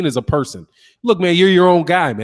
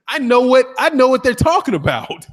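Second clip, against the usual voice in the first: laughing; 7 semitones higher, 3 dB louder than usual. Real, not acted. Realizing the slang meaning.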